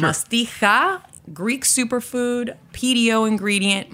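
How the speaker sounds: "Mastica" stretched slow and high; slow emphatic contrast. Shown as a demonstration. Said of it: "two very distinct things".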